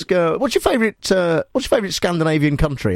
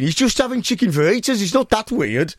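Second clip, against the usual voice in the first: higher, put-on Brummie protest; mock objection in a Birmingham accent; 9 semitones higher than usual. Host defends caller's fajitas to co-host, Birmingham accent. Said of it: "I'll do Birmingham".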